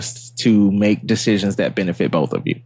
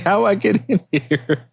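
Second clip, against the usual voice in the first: high, puzzled mock question; imitating a confused friend; 11 semitones higher than usual. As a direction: play him lost at the door. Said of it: "It's like".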